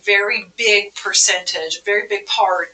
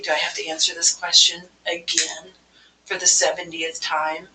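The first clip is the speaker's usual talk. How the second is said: softer, weary muttered complaint; exasperated staff; 3 semitones lower, 4 dB quieter than usual. Shown as a demonstration. Staff member fed up with a guest's repeated question, weary. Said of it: "hear the exasperation".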